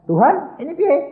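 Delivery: high, loud appeal to God; retold plea. Retold desperate cry to God. (seruan memelas kepada Tuhan)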